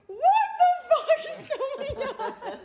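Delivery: very high, drawn-out squeal breaking into laughter; loud, laughing mock wail of disbelief; hammed-up bewilderment. Diner reacting to the waiter's odd retort, hammed-up bewilderment.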